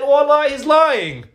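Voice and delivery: high, drawn-out acted protest; retold shout. Umar denying Jamil's claim from behind, imitated shout.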